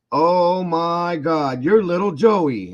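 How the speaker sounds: put-on drawn-out old man's wonder; old man realizing who is driving him, slow and high; amazed old man.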